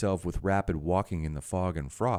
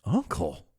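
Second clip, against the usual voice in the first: high, rising appeal; pleading; 13 semitones higher than usual. A narrator's reading. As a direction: plead upward on the word. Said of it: "pleaded the nephew".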